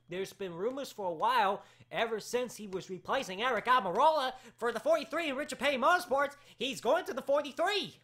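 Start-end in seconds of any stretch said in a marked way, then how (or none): 1.9-8.0 raised, higher exasperated insistence. Real, not acted. Host correcting a repeat questioner about Bubba, exasperated. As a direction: hammer the answer home, worked up.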